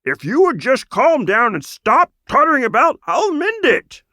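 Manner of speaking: high, loud fussy croaking voice; flustered toad. A narrator's reading. Toad wizard trying to calm a frantic raccoon, flustered. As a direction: scold the patient to sit still.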